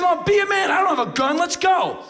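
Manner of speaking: high, loud mock challenge; retold goading yell. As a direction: goad him at full volume. Acted intruder goading him.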